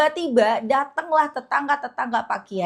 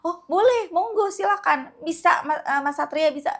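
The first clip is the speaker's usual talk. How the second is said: eager kiai; 7 semitones higher than usual. Retold or imitated.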